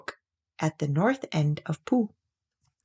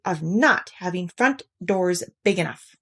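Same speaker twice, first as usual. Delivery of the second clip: usual speaking voice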